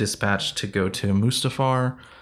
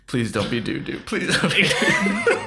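mock plea, climbing higher on the repeat; lightly acted Anakin pleading; 5 semitones higher than usual. Joking with co-host as Anakin dreads Dooku, mock pleading. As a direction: beg in jest, breaking toward laughter.

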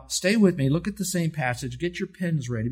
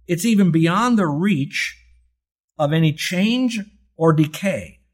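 1 forceful preaching; 4 semitones higher, 6 dB louder, slower than usual. Real, not acted.

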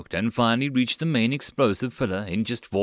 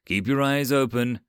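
yelled warning; 6 semitones higher than usual. A narrator's reading. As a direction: call the squad to attention. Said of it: "Teria yelled".

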